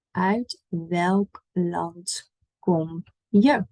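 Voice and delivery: very slow, each word of Uit welk land kom je apart; slow demo. Shown as a demonstration. Teacher modelling the Dutch question slowly. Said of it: "Welk is which".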